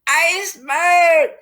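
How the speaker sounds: high, loud and slow, calling out a warning; radio operator shouting an iceberg warning to ships; urgent alarm call. A narrator's reading. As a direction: sound the alarm over the radio. Radio operator sending ice alarm.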